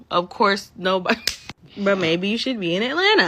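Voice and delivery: ordinary talk, nothing marked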